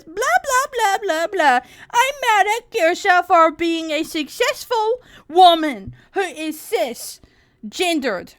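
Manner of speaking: high, loud, whiny mocking voice; mocking Anna. Streamer mocking a critic's words in a whiny voice. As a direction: sneer it out as a nasty caricature. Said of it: "Anna says".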